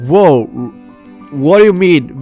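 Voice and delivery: ordinary talk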